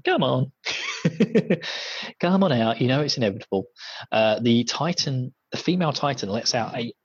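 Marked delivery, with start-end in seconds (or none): 0.0-3.7 taunting Levi. Retold or imitated. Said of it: "standing on her head going".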